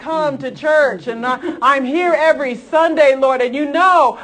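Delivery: a Pharisee boasting to God so that everyone hears; smug Pharisee prayer. Retold or imitated. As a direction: ham up a self-righteous prayer said for show. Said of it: "don't be like the Pharisees that pray in the open and says".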